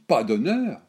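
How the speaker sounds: put-on old woman flaring up at the question; indignant retort. A narrator's reading. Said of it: "La vieille bondit".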